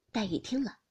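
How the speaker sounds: ordinary delivery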